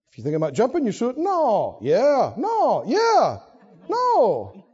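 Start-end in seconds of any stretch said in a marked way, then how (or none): 1.2-4.6 alternating no and yeah; dueling voices. Acted. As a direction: play both sides of argument. Back and forth inner voices.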